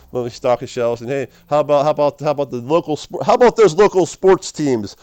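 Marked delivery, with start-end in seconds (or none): 1.1-5.0 louder, rising eager chatter; hammed-up chatty clerk; mock eager small talk.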